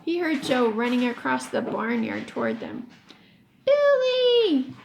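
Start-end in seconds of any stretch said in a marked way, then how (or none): 3.7-4.7 loud high shout of a name; sharp alarmed shout. Acted. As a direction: yell his name in alarm. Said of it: "Alan shouted".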